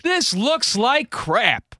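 high, slow mock outrage; a lightly voiced outraged husband, drawn out; husband walking into the remodeled house, slow and indignant; high slow indignation. Washington coming home.

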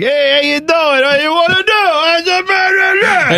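high, drawn-out caricature of a New Yorker; mock New York accent. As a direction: put on a hammed-up New York accent, stretching the words out. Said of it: "maybe their accent is more like".

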